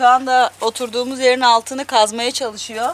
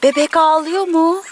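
pet talk; 8 semitones higher than usual. Real, not acted. Owner asking pets about the crying baby, high cooing. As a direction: coo it high to an animal.

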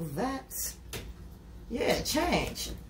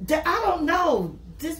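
ordinary delivery

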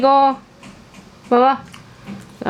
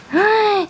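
delighted gasp; 8 semitones higher than usual. Real, not acted.

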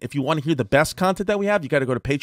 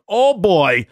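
imitated alarm, higher and louder; Russian doctor; 5 semitones higher, 5 dB louder than usual. Doctor hearing he must fly Thursday, mock alarm. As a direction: burst out in mock dismay. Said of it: "this is a Russian doctor".

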